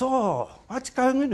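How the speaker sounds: high, slow, surprised patient voice; imitating a patient stretched out and high; mock surprised patient. Retold patient greeting, doctor story.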